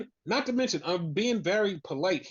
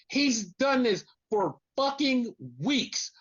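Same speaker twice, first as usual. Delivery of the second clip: usual speaking voice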